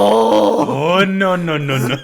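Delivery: high, loud wail of no's, descending; hammed-up horrified embarrassment; mock panicked realization. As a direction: wail it out in comic dismay. Acts out Orga's horrified realization.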